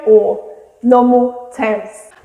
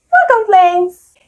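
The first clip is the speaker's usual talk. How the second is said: high, loud, drawn-out NO COMPLAINTS; super positive; 8 semitones higher, 6 dB louder, slower than usual. Shown as a demonstration. Teacher showing an upbeat reply to "how are you", super positive. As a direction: sing out your optimism on every syllable. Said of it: "someone who is super positive and optimistic".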